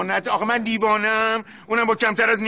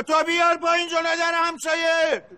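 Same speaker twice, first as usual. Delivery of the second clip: raised, higher voice, snapping at the carpet man; heated; 6 semitones higher than usual. Acted. Older brother flares up at the shopkeeper, raised angry voice. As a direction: pitch climbing in irritation. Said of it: "داداش، آروم یه وقت".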